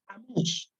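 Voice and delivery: usual speaking voice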